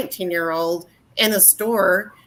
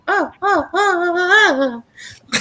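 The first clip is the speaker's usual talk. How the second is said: high whimpering mock wail; hammed-up sobbing oh's; mock crying; 10 semitones higher, slower than usual. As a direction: whine like offended crybabies. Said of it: "everyone was just like".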